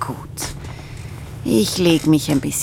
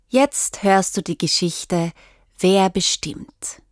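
usual speaking voice

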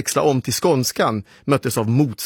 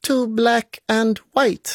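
put-on young boy announcing; 10 semitones higher than usual. Boy announces invented band name.

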